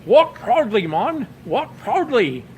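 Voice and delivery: solemn Scottish cheer to a walked batter; Sean Connery yell. Retold or imitated. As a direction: bellow it grandly, stretching the last words. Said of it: "and yells out".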